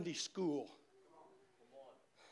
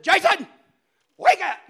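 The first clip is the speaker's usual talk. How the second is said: much higher and louder urgent call to a brother; urgent kid's call; 11 semitones higher, 17 dB louder than usual. Retold or imitated. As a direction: wake him up, there's a ghost. Kid waking brother about ghost.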